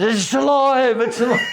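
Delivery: high, hammed-up "creature lives" yell; mock horror cry. Comic jumping on the bloomers on stage, mock horror cry. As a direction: cry it out high, half laughing.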